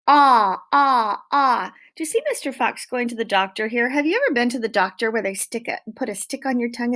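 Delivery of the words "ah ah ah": loud open ah, three times; repeated ah sound. Shown as a demonstration. Teacher to children modelling the vowel, loud open ah. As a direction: sing out ah like at the doctor. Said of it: "O makes an ah sound".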